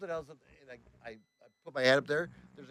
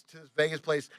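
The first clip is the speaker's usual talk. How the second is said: ordinary talk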